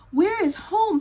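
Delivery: lost chick calling out; crying out. Acted. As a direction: call out for home, worried. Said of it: "Pip cried".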